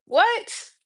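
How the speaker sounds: high rising disbelief; stunned what. Real, not acted. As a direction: ask it as if you can't believe it. Disbelief after a sigh.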